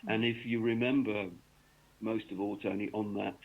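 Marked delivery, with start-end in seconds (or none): none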